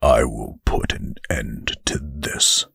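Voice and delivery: low, resolute angel voice; a stern angel declares his intent; grave angel. Acted. Angel vows to fight.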